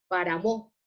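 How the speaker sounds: "páramo" misstressed as para-MÓ; wrong stress. Shown as a demonstration. Teacher showing what we don't say, final stress on "páramo". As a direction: shift the stress of "páramo" off PÁ to the end. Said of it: "No decimos páramo".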